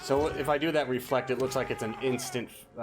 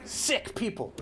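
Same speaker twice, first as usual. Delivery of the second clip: outraged shout; 10 semitones higher, 5 dB louder than usual. Acted. Player voices outraged game character.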